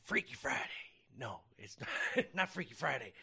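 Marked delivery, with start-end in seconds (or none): none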